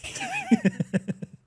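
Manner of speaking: squealing laugh; breaking into high laughter. Real, not acted. Laughing during the bit.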